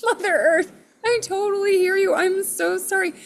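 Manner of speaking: high-pitched reenacted apology; placating sympathy. Her placating reply to Mother Earth.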